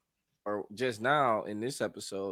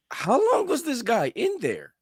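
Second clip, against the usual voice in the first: amazed disbelief; 13 semitones higher, 6 dB louder than usual. Real, not acted. Podcast cohost asking his partner, in amazed disbelief. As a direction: pitch shoots way up, louder, in amazed disbelief. Incredulous question about time passed.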